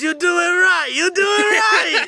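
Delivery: high, drawn-out shouting, half laughing; excited imitation. Teller mimicking laughing camp kid, excited mock shout.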